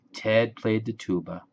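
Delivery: ordinary delivery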